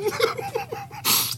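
laughing. Real, not acted. Laughing at the other's face.

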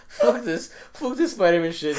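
high loud laughing imitation; laughing mock quote. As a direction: blurt it out while laughing. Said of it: "he's like".

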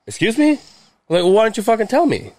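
high-pitched retold indignation; indignant comeback. Retold indignant reply to dad.

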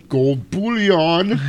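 hammed-up villain savoring the words; slow mock spy-movie announcer. Retelling a sixties spy movie, slow grand mock delivery.

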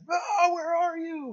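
high, wailing plea into the dark; desperate cry to God. Retold or imitated. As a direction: cry out lost and scared. Crying out to God in valley.

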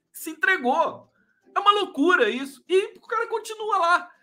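high, incredulous outcry; indignant. Real, not acted. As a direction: say it in shocked disbelief. Indignant disbelief.

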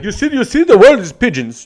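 lampoon the pompous teacher; mocking the teacher. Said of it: "He's like".

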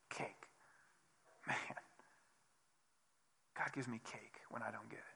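soft near whisper in wonder; hushed, wondering whisper; hushed awe. Real, not acted. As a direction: let it land quietly, amazed. Awed point about grace.

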